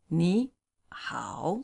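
"ni hao." with ni rising only; rising ni, then dipping hao; tone sandhi demo. Shown as a demonstration. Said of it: "so it's, it's".

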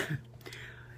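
tiny whispered giggle; stifle a little giggle; muffled giggle. Real, not acted. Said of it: "laughing with the little giggle".

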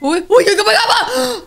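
very high, loud frantic pleading; urgent high-pitched panic; panicked. Real, not acted. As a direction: squeal in alarm. Panicking at game scare.

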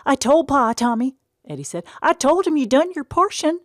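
put-on very high, loud kid voice; little boy voice. Eddie insisting to Tom he defended him, high eager child voice. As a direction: pipe up like an eager little brother.

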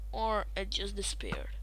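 soft unvoiced whisper. Real, not acted. Quietly wondering where the arrow went, whispered. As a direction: breathe it out as an aside. Muttered aside.

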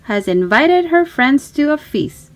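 put-on lively storybook reading for a small child. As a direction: read it like a bedtime picture book, bright and inviting. Reading picture book to child.

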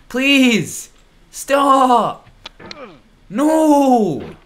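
high loud pleading screams; desperate screaming. Real, not acted. Gamer falling again, high desperate screams. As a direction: beg the game to stop at full volume.

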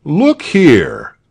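high, loud indignant protest; angry. A narrator's reading. Tell confronts the soldier after the blow, angry. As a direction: start an indignant complaint loudly. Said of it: "Then he was angry".

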